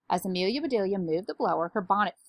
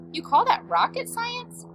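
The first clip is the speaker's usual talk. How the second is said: high, slow disbelief; incredulous question; 4 dB louder, slower than usual. A narrator's reading. Doctor Dinglebat questions the housekeeper's claim, incredulous. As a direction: pitch it right up in disbelief.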